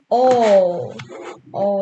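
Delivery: sudden loud startled oh. Real, not acted. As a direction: jolt at the drop. Sees a drop in game.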